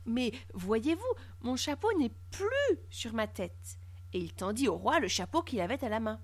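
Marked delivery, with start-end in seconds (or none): 0.1-3.8 soft, slow, careful explanation; very polite. A narrator's reading. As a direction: explain meekly, taking time over each word. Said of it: "répondit Barthélemy très poliment". (très poliment, lentement et doucement)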